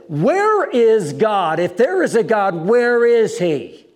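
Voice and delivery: slow, loud, high retold challenge; drawn-out agitated yell; agitated shouting. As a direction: imitate a heckler bellowing at the preacher. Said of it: "he started getting agitated again".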